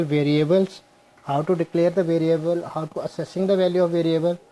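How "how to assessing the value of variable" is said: usual speaking voice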